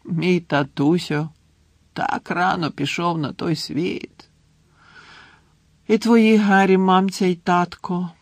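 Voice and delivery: high, drawn-out maudlin wail; tearful drunken lament. A narrator's reading. Drunk Hagrid mourning Harry's parents, tearful lament. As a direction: mourn them tipsily, stretching the words.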